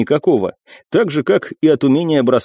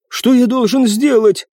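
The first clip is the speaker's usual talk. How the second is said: high servant voice; 11 semitones higher than usual. Acted. Servant asks his master.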